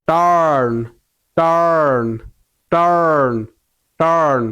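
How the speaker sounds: টার্ন drawn out on the R before the N; long held R. Shown as a demonstration. Teacher drilling the same R sound in another word, long-held টার্ন. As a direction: stretch and hold the R inside টার্ন. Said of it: "আরটা কি ধরে রাখতে হবে".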